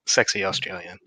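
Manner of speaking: low, soft mock sexy Australian; mock-sultry Australian murmur; mimicked Australian accent. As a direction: tease a friend's bad Australian accent. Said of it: "I didn't know that was an Australian accent".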